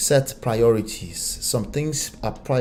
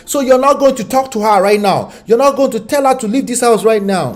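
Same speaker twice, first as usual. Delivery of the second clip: higher, louder indignant demand; indignant mother; 9 semitones higher, 7 dB louder than usual. Retold or imitated. Mocking mother's demand on son.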